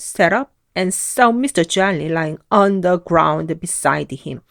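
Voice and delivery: plain reading voice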